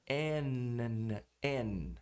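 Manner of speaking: N inglês alongado, língua firme no céu da boca; N pressionado. Shown as a demonstration. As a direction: segure o som final do N. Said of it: "tem que pressionar o céu da boca". (N com a língua pressionando o palato)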